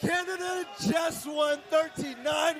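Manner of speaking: loud, slow, high triumphant call; excited shout. Real, not acted. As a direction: shout the result to the crowd. Result announced, caster erupts.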